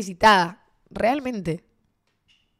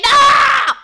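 put-on high, very loud drunken-night yell; all-out shout; 13 dB louder, much slower than usual. Friend fending off a pushy guy at a party, all-out shout. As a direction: scream it to drive someone away. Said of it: "Empezamos a gritar con Brisa".

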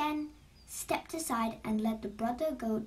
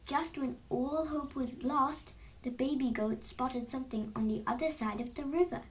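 usual speaking voice

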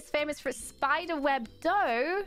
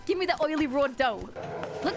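normal talking voice

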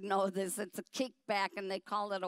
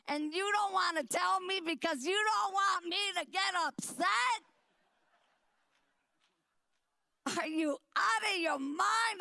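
higher, indignant shouting at the staff; furious retelling; 7 semitones higher than usual. Retold or imitated. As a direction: let the outrage burst out at him. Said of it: "I'm, and I'm furious now".

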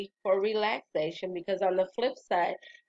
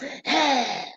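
defiant sigh; 5 semitones higher than usual. Retold or imitated. Showing the boss her sigh, a defiant huff. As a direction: sigh right back at her. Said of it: "She said that".

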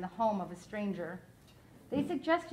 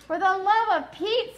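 put-on higher, louder exasperated girl; 8 semitones higher, 8 dB louder than usual. Flora snapping at the doctor, exasperated and louder. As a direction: blurt it out, fed up.

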